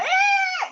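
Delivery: high mock cry of a baby; toddler wail. Mimicking the baby waking to find the nanny, high wail. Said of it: "he was crying like".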